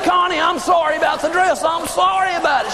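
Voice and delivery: high, shouted mock apology; panicked apology. Husband apologizing to screaming wife about the dress, panicked.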